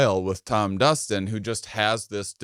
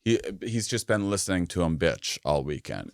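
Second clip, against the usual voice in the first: usual speaking voice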